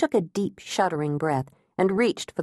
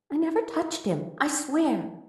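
much higher, softer, pleading tone; protesting innocence; 9 semitones higher, 4 dB quieter than usual. A narrator's reading. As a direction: swear it like a caught child.

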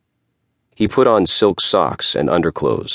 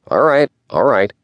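grudging; 8 semitones higher, 3 dB louder than usual. Acted. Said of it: "Isher's nasal voice".

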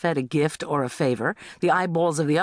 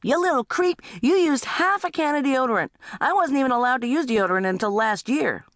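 put-on high-pitched teen outrage; indignant teenager; 11 semitones higher than usual. Teenager accusing little brother, high indignant complaint. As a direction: scold a pesky sibling, pitched way up.

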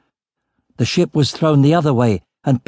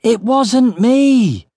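high, drawn-out desperate bawl; 11 semitones higher, much slower than usual. A narrator's reading. Boy bawls his denial back over howling wind, bawling. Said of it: "I bawled back".

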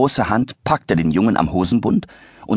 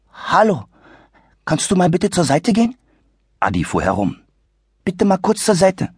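put-on lightly higher, friendly young voice; a hint of a polite boy; 10 semitones higher than usual. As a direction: ask nicely like a well-mannered kid. Polite boy asks to pass.

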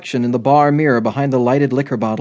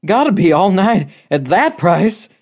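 higher, flustered little man; sputtering; 5 semitones higher than usual. A narrator's reading. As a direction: blurt it in a fluster. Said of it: "he sputtered".

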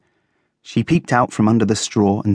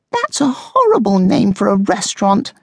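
very high, scornful guinea pig; high-pitched disdain; disapproving; 12 semitones higher than usual. A narrator's reading. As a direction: object in a squeaky-high voice. Coco scorns Fuzzy's exciting news.

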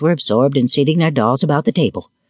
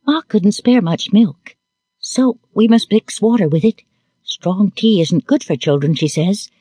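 put-on higher child voice, earnest; little girl's earnest explaining; 8 semitones higher than usual. A narrator's reading. Little girl explaining the watered milk, earnest.

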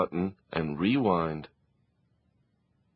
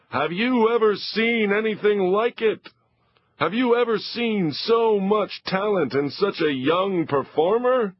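high, bright showman voice booming to the audience; excited TV host; 10 semitones higher than usual. Acted. TV host wowing the studio audience, high and excited. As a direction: hype it up like a game-show host.

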